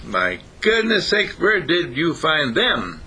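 high, slow rabbit exclamation; acted astonishment. Daddy Rabbit sees the babies, slow high astonishment.

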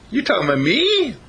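high, incredulous rabbit voice; acted disbelief. Rabbit answers flirting bunny, high-pitched disbelief.

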